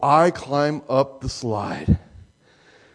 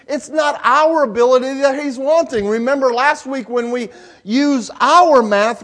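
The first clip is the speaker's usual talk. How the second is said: rising, louder preacher's cadence; preaching; 5 semitones higher, 3 dB louder than usual. Acted. Pastor presses the lesson on his congregation, fervent preaching. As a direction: drive the point home to the congregation.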